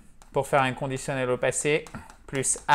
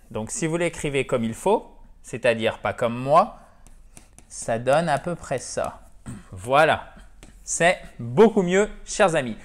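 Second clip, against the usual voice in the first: ordinary delivery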